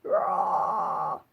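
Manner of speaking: breathy whispered scream. Shown as a demonstration. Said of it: "a whisper scream, 'cause it's quite soft".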